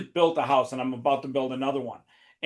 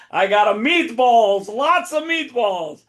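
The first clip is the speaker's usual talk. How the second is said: put-on big, high, stretched-out Italian accent; mock Italian accent; 8 semitones higher, 5 dB louder, slower than usual. Player denying mockery while doing it, mock Italian accent. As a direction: belt it slowly in a hammed-up Italian accent. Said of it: "making fun of your accent".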